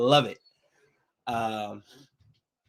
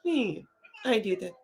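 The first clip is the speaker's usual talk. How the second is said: mock innocent Bruce Lee; 10 semitones higher, 6 dB quieter than usual. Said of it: "Bruce Lee's like".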